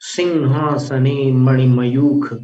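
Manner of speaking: normal talking voice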